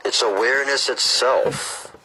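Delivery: slow, measured read-aloud; book reading. Acted. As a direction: read it out deliberately, phrase by phrase.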